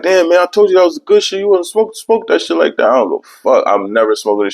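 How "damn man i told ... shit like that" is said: high, fast told-you-so friend; hammed-up high friend voice. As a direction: gloat like a friend who warned him. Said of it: "He like".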